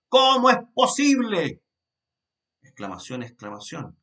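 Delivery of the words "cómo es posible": «¿Cómo es posible?» exclaimed much higher and louder; exclamation. Shown as a demonstration. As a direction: throw it out as a loud astonished exclamation. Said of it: "como signos de exclamación".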